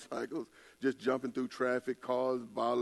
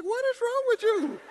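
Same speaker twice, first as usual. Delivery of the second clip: shocked disbelief; 6 dB louder than usual. Retold or imitated. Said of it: "I'm like".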